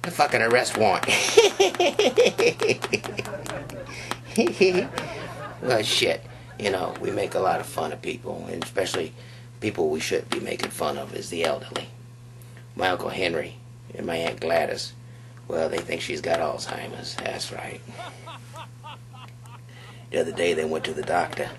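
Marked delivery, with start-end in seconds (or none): 1.1-5.6 breathy, very high wheezing laugh; long high cackle. Real, not acted. Comic howling at his own arrest-warrant joke, high wheezing laugh. 15.5-17.8 hushed, secretive aside; confiding whisper. Real, not acted. Comic whispering gossip about his aunt to the crowd.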